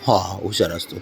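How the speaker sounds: soft, low, soothing agreement; tender praise. Real, not acted. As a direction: reassure the little one gently. Father praises child softly.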